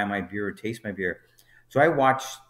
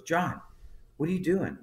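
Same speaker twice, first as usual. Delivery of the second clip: high-pitched, puzzled questioning; retold baffled boss, voice raised in pitch; puzzled manager; 7 semitones higher than usual. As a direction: imitate a manager taken aback. Manager catches him packing up early.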